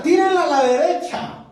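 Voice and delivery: high shouted instruction; calling out. Acted. Jesus shouts to the fishermen to cast right, high call. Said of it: "Le grita".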